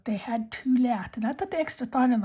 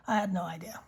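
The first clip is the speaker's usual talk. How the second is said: ordinary talk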